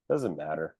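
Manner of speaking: low, soft dismissive butler voice; Alfred impression. Butler dodges the master's question, low and dismissive. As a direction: brush it off quietly, dropped in pitch. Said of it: "Alfred literally is like this".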